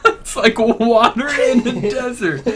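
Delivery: laughing. Real, not acted. Repeating laughing.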